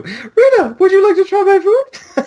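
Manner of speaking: very high and sweet offering food; hammed-up high girlish voice. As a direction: sing it up an octave, cutesy. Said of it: "her voice is a full octave higher".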